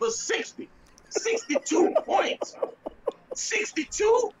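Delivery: high, incredulous laughter through the words; laughing disbelief. Real, not acted.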